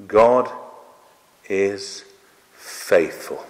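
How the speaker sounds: each word alone, with long pauses, falling in pitch; three separate words. Real, not acted. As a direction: land "God", pause, "is", pause, "faithful". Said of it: "I can say it in three words".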